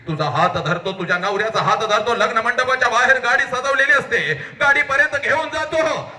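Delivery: high, urgent declamation; fervent high delivery; impassioned oratory. Acted. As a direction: build quickly into a soaring cry.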